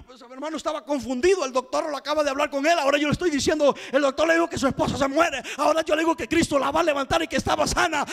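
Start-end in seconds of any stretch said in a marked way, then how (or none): none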